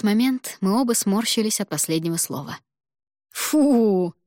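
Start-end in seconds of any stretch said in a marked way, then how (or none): 3.3-4.1 long, disgusted groan of a word; drawn-out disgust. A narrator's reading. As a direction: stretch it out in shared disgust. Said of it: "в один голос протянули мы".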